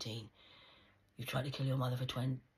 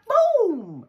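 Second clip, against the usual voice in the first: shouted boom explosion; 21 dB louder than usual. Pretend.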